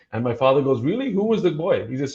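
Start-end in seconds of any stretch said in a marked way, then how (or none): 0.8-1.9 surprised. Retold or imitated. Father surprised at the reception.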